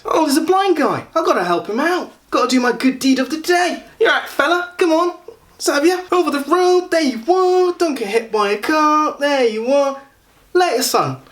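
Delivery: very high-pitched Essex do-gooder caricature; mock Essex accent. Passer-by steering a blind man over the road, mock Essex accent. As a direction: squeak it out as a patronizing Essex helper. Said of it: "just me stereotyping people from Essex".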